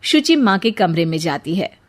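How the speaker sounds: normal talking voice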